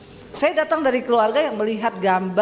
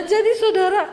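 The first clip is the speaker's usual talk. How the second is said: ordinary talk, nothing marked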